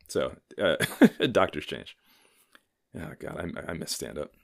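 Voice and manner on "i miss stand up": usual speaking voice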